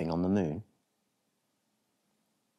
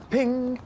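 much higher, louder bright ping; springy ping; 4 dB louder than usual. Acted. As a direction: snap it out like a line pinging. Washing line snaps.

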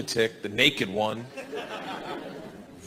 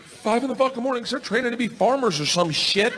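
higher-pitched exasperated complaint; exasperated rant; 6 semitones higher, faster than usual. Acted. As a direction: rant in disbelief at the early hour. Exasperated rant at early waking.

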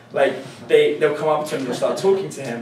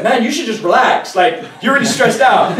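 mock mugger soothing; 8 semitones higher, 8 dB louder than usual. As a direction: talk him down, half laughing. Mugger calming him down.

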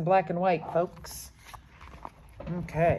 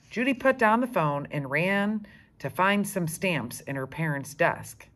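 ordinary delivery

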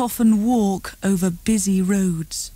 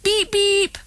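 high playful horn noise; car horn beep; 11 semitones higher than usual. A narrator's reading. Boy on bike playing car horn, high and playful. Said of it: "went Tim".

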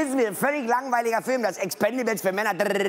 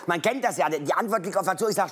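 ordinary talk

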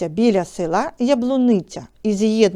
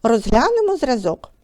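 ordinary delivery

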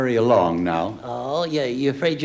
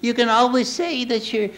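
dummy voice; 10 semitones higher than usual. Acted. Ventriloquist dummy Charlie's voice.

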